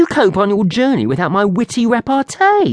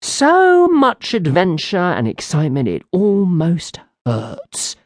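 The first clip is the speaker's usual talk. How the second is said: sighing; 7 semitones higher, slower than usual. Acted. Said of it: "Cedric sighed".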